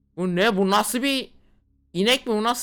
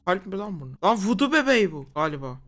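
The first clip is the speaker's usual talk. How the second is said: ordinary talk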